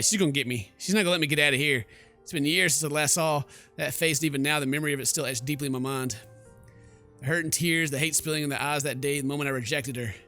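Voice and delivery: usual speaking voice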